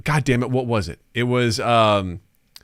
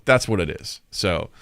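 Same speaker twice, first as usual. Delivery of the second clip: ordinary talk, nothing marked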